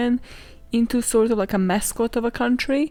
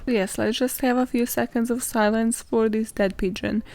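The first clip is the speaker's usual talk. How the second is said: normal talking voice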